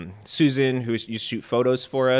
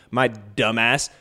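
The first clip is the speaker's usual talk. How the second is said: normal talking voice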